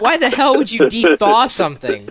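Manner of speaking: incredulous, laughing "Why the hell would you de-thaw something?"; retold baffled rant at his wife, with a laugh; mock exasperation. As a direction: scoff at the idea, half laughing. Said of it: "I'm like".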